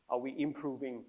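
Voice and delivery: normal talking voice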